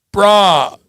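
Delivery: badly imitated surfer-turtle dude accent; TikTok dude's accent. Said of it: "I'm butchering his, his accent".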